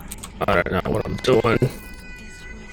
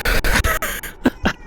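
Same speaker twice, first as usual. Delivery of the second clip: shrieking laugh, pitched way up; high squeal of laughter. Real, not acted. Gamer squealing with laughter after a sudden scare.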